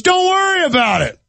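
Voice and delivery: high mocking brush-off; mock cop telling him to move; imitating a rude cop. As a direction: sneer it out as the dismissive cop. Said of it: "He goes".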